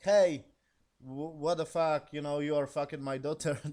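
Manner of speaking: loud, high retold yell at the uncle; mock angry king. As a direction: bark it like a furious king catching him out.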